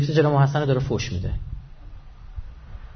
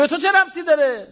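angry retort; 14 semitones higher, 4 dB louder than usual. Retold or imitated. Master berates the stranger angrily.

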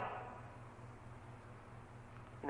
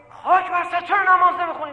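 put-on high, loud furious scolding of a child; angry scolding parent; 12 semitones higher, 9 dB louder than usual. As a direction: yell at the kid in a rage, pitch way up.

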